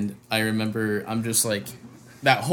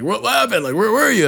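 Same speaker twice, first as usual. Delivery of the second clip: much higher, worried questions; concerned surprise; 9 semitones higher, 4 dB louder than usual. Retold or imitated. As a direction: imitate friends asking, pitched way up. Bandmates asking where he was.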